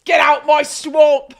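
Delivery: loud, slowed-out playful bellow; mock ogre shout. Streamer in Shrek costume playing to chat, mock shouting. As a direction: shout it slowly as a pretend ogre command.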